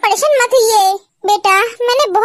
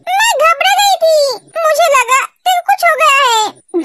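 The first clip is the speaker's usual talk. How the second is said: slow, crying out in fear; slow, tearful, frightened outburst; 2 semitones higher, slower than usual. Acted. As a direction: plead through tears. Sister explains fear, crying.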